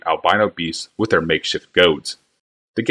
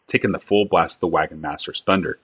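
usual speaking voice

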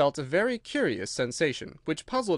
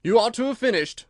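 King voice; 8 semitones higher, 7 dB louder than usual. A narrator's reading.

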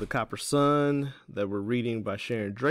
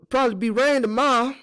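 very high, loud character voice; folksy Teeny, changing subject; 9 dB louder than usual. Acted. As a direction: announce the weather in a high folksy voice.

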